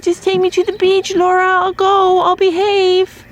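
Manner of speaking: high, squeaky, begging little goat voice, slowing at the end; pleading goat voice. Retold or imitated. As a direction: beg like a spoiled goat wanting the beach. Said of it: "She's like".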